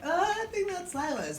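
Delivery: very high-pitched put-on voice; hammed-up high voice for a startled boy; mock realization. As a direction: squeak out the guess. [name]'s acted reply.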